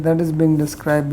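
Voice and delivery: ordinary delivery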